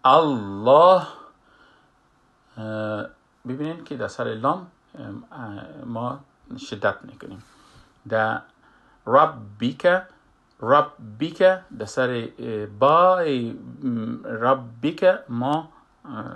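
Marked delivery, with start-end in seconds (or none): 0.1-1.0 الله with the lam held long and doubled, much louder; doubled lam demo. Shown as a demonstration. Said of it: "باید لام مشدد باشه". 9.1-15.7 ربک said slowly with a doubled b, rabb-ak; slow, louder teaching demo of ربک; shadda on ربک. Shown as a demonstration. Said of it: "شدت داریم".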